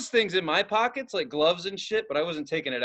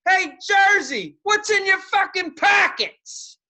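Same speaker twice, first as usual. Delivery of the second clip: mocking shout; 11 semitones higher, 5 dB louder, slower than usual. Taunting guard while leaving ride.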